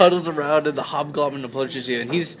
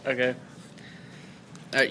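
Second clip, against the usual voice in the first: normal talking voice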